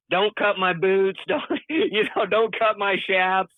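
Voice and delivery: half laughing, beg them to spare the boots; old cowboy plea. Retold or imitated. Injured rider begging the nurses, mock cowboy plea. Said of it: "any other old cowboy".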